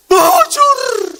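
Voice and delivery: very high, loud yell of BUJUR; shouted. Shown as a demonstration. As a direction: belt out BUJUR at full voice. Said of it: "Teriak dia".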